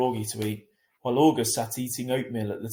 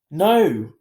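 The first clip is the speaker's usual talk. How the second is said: loud, firm flat no; 5 semitones higher, 12 dB louder than usual. A narrator's reading. Via cutting off her mother firmly, loud. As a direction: shut it down firmly. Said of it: "I said firmly".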